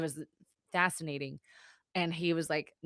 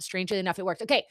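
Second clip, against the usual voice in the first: ordinary talk, nothing marked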